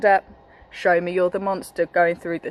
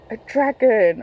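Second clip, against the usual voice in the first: mock gasp; 5 semitones higher than usual. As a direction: gasp then a higher, surprised reveal. Reveals dragon picture to children.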